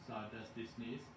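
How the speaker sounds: plain reading voice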